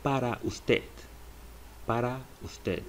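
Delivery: para usted, PAH-rah oos-TEH; Spanish phrase. Shown as a demonstration. Teacher repeats para usted for learners. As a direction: para usted said clearly twice. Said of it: "is pronounced as".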